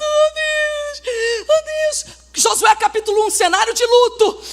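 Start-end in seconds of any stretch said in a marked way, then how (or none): 0.0-2.2 high, soft mock sob; Joshua crying. Joshua mourning Moses, crying out to God. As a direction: wail to God, hammed-up grief. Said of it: "Josué chorando".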